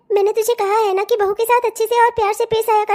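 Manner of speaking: ordinary delivery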